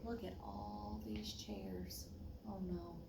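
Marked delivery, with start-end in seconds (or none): none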